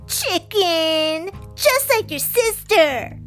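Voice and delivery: put-on high-pitched bully girl jeer; yelled taunt. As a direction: holler it after him like a playground bully. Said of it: "yelled Tuffy".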